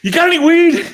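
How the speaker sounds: hammed-up eager stoner ask. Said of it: "he starts going like this".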